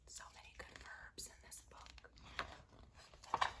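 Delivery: slow whispered aside; hushed, slow side comment. Real, not acted. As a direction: murmur it to the listeners. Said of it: "whispered aside".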